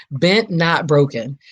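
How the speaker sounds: drawn-out "Bent, not broken" with Bent stretched; slow emphatic. Shown as a demonstration. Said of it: "that's right".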